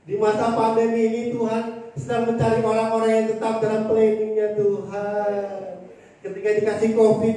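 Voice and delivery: loud, driving sermon cadence; forceful preaching. Real, not acted. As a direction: hammer each line home to the congregation.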